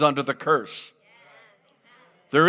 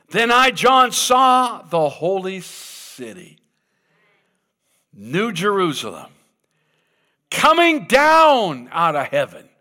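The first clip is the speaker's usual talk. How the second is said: rising, louder preacher's reading; preached scripture reading; 3 dB louder than usual. Acted. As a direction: proclaim it to the congregation. Reading scripture at full force.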